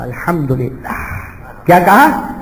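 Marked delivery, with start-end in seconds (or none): 0.0-0.9 calm thanks. Retold or imitated. Saint's calm reply to loss.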